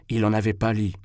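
plain reading voice

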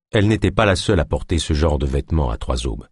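plain reading voice

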